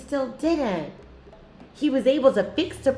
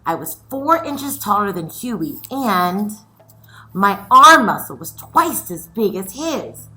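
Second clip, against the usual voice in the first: lively read-aloud storytelling; story reading; 5 semitones lower, 7 dB louder than usual. A narrator's reading. Teacher reading a picture book aloud to her scholars, lively.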